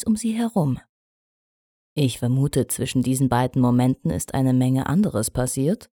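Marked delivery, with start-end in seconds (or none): 1.9-5.9 a hint of a lower male voice; relieved young stallion, lightly voiced. A narrator's reading. Shining Armor speaking again.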